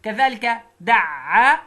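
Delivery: drawn-out دع said slowly and clearly; slow syllable model. Shown as a demonstration. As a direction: stretch out دع for the child. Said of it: "teacher models syllables".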